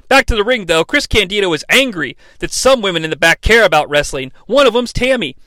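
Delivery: ordinary talk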